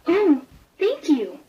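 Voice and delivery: high, bright thank you from a small kitten; grateful kitten. A narrator's reading. The shy kitten thanks the puppy, in a high grateful voice.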